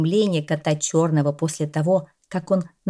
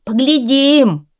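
loud, high, slow furious cry; the cat angrily echoing the dog's challenge; 8 semitones higher, 8 dB louder, slower than usual. A narrator's reading. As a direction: back up the dog with fury. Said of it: "яростно вторил ему кот Белый".

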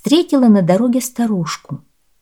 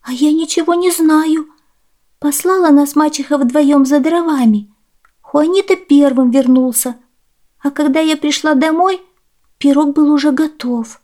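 put-on high, girlish storytelling voice; high-pitched child's account; little girl explaining; 8 semitones higher, 4 dB louder than usual. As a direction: plead innocence as a young girl.

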